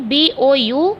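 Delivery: letters spelled one by one, slowly; spelling out letters. Shown as a demonstration. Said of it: "तो मैं बता दे रही हूँ". (धीरे धीरे अक्षर बोलकर स्पेलिंग)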